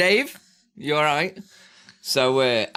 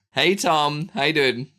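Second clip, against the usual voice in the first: usual speaking voice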